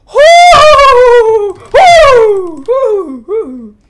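loud high whoops dying away; whooping. Real, not acted. Streamer venting after a long frustrating run, fading high whoops. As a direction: whoop loudly, then let each one fade.

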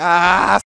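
long, loud "aaah" of discovery; loud realisation "ahh". Real, not acted. Streamer gets the shop-name joke, loud realisation "ahh". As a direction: let out a drawn-out "ah", catching the trick.

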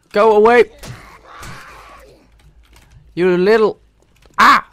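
loud, high angry shout; furious shout mid-fight; yelling. Real, not acted. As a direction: drive the zombie off. Fighting off zombies.

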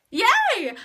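loud, high squeal of joy; excited cheer. Real, not acted. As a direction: yelp with delight. Excited about being cast as Elsa.

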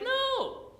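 alarmed no. Retold or imitated. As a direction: recoil at the very idea. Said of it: "We'd have been like".

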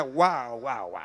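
low, fading wows; awestruck, dropping almost to nothing; awed murmur. Retold or imitated. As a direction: let amazement sink into a deep hush. Acting his amazement at the revelations.

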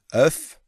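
œuf said as a rounded euf, no N; French teacher modelling œuf. Shown as a demonstration. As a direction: model œuf as the bare egg word. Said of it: "Nếu mà không có chữ N thì mình đọc là œuf".

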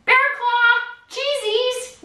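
high, sing-song enticing; playful high sales pitch; hyped-up teacher showing off. Shown as a demonstration. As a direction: dangle it like a treat. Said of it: "try to be like".

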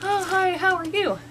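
first sniff at a new friend; voicing the dog meeting the neighbor's dog, eager; eager dog greeting. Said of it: "First time she met him".

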